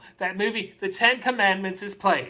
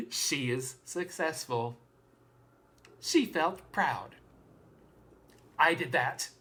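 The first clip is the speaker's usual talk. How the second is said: hushed pride; 4 semitones lower, 8 dB quieter than usual. Acted. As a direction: bring it right down, letting each sentence land. Quiet proud close of monologue.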